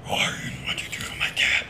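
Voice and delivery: whisper. Pretend. Player whispers in character.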